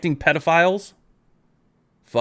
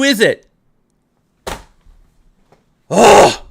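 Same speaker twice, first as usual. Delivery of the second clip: loud, high furious rant, shouting every line; furious yelling; 6 semitones higher, 10 dB louder than usual. Real, not acted. Ranting at David for voting Trump, furious loud yelling. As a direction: yell it at him, full crash-out rage. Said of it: "I'm not gonna apologize for yelling, shouting".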